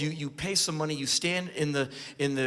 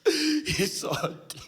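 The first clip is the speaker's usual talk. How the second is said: high, wailing hammed-up despair; over-the-top weeping lament; mock sobbing mother; 12 semitones higher, 4 dB louder than usual. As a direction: wail like a hysterical mother. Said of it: "she's in tears".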